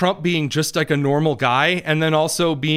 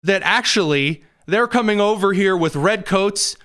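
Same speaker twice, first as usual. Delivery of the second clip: ordinary delivery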